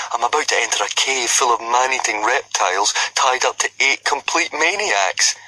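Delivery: lightly voiced nervous boy, sarcastic; gloomy Fishlegs. A narrator's reading. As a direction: grumble the danger out, last words slowed. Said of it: "muttered Fishlegs".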